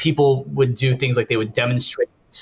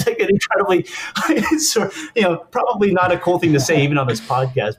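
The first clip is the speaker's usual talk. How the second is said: talking through a laugh, pitch lifted; amused, laughing; 5 semitones higher than usual. Real, not acted. Guest owning up to a risky joke, laughing. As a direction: let real laughter break up the words.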